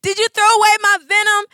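put-on high, loud tearful kid voice; crying child. Mother voicing her upset son on the phone. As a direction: imitate a little boy near tears. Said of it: "I could tell he was crying".

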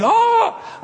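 high shouted Ah of astonishment; a sudden high cry of wonder; amazed shout. Retold or imitated. Crowd realizing the answer, high shouted ah.

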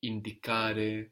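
"Indicare" slowly, stressed on CA; penultimate stress. Shown as a demonstration. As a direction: draw out the CA in "Indicare". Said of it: "accent on the penultimate syllable".

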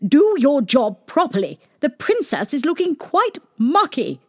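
high, loud fussy queen scolding; cartoon queen shouting. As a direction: bark orders like a fussy queen. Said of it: "shouted at the servants".

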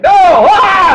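high panicked shout; hypnotized scream. Retold or imitated. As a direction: scream as if reliving trauma. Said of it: "acting it out".

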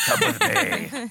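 squeaky high, slow and laughing; high mock sweetness through a laugh; customer service voice. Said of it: "happy customer service voice".

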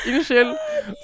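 "Unnskyld!" shot up very high and loud; squealed apology. Retold or imitated. Apologizing to the board the moment they walk in, squealed high.

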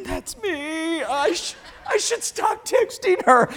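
high-pitched, drawn-out mock lament; mock confession. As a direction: imitate listeners guiltily owning up in a high voice. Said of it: "now people are going".

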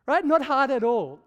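ordinary talk

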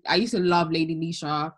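ordinary delivery

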